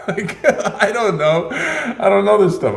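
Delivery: high, slow, laughing protest; giggly innocent denial. Real, not acted. Giggling denial about prices.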